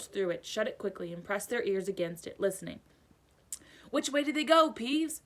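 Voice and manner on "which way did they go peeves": higher, urgent caretaker; demanding caretaker. A narrator's reading. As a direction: press for an answer. Filch demanding from Peeves.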